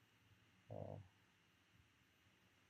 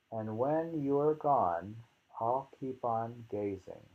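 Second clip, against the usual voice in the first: poem reading; 6 dB louder than usual. Acted. Reads poem, louder.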